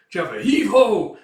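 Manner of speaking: high, loud effort cry; heave shout. Acted. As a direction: push the battering ram. Ramming the gate.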